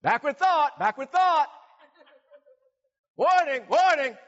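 loud, high-pitched alarm call; warning alarm. Acted. Preacher acting out an inner alarm over bad thoughts, loud warning. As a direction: sound it like an automated alert, repeated and loud. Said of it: "See, the alarm will go off".

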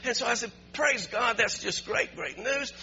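high, loud, joyful celebration of good news; excited rejoicing. Real, not acted. Pastor rejoicing at answered prayer, loud and joyful.

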